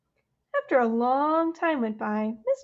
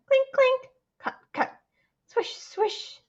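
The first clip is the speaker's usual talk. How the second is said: playful sound effects; 12 semitones higher than usual. Acted. Building sound effects in story.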